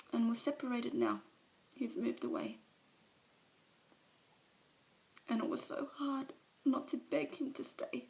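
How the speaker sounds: Alice breaking down before the meeting; tearful confession. Acted. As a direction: let the shame and grief spill over. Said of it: "a monologue from one of, of her movies".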